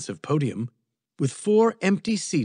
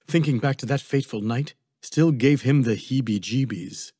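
plain reading voice

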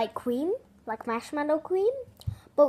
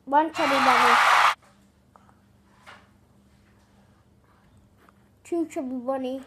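cheeks stuffed full. Real, not acted. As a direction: mouth packed with marshmallows. Marshmallows stuffed in mouth.

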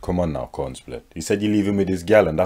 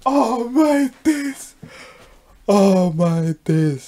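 slow, stretched high lament; drawn-out dismay; 8 semitones higher, much slower than usual. Acted. Reacting to the botched accent, slow wailing dismay. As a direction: stretch each word out in disbelief.

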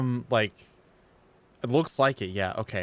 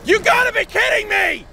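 loud, high outraged shout; disbelieving shout; 10 dB louder than usual. Real, not acted. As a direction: yell it in disbelief. Reacting to game events.

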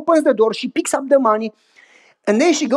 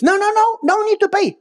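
much higher voice for the booth attendant; toll booth lady; 11 semitones higher than usual. Retold or imitated. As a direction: lightly play the cheerful booth lady.

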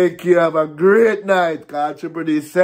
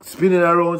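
ordinary talk

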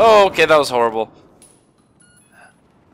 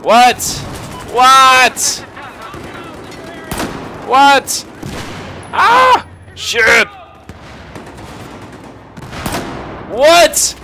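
shocked yelling; 9 semitones higher, much slower than usual. Real, not acted. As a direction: blurt out each what in disbelief. Player shocked in game fight.

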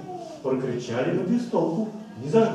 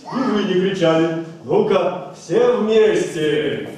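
louder urging; 5 semitones higher, 7 dB louder, slower than usual. Acted. Urging children to shout.

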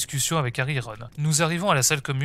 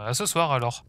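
ordinary delivery, nothing marked

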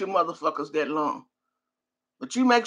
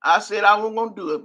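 usual speaking voice

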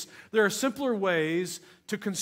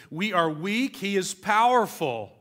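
weak then a louder powerful; building emphasis; 4 dB louder than usual. Acted. Preacher to congregation, punching powerful louder. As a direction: lean hard on powerful. Preacher contrasts man and God.

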